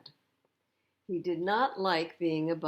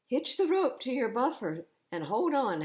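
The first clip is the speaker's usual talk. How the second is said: louder, higher urgent call; cried; 6 semitones higher, 5 dB louder than usual. A narrator's reading. Helicopter Harold calling down to Thomas, urgent. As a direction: call the order over the rotors. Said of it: "Harold cried".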